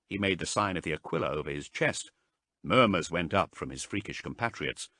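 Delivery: plain reading voice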